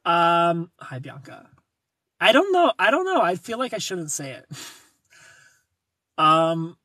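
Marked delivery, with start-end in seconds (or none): 2.2-2.7 high, loud squeal with a falling end; squealing dodge. Real, not acted. Teased about the show, squealing a coy refusal. 2.8-3.2 high, loud squeal ending on a rise; squealing dodge, rising. Real, not acted. Still teased about the show, squealing with a rising end.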